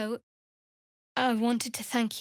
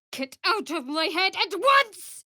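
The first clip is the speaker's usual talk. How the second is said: angry command; 8 semitones higher, 3 dB louder than usual. Acted. Mind intrusion, commanding.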